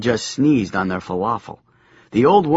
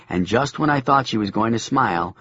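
ordinary delivery, nothing marked

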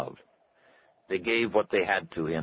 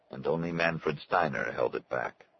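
usual speaking voice

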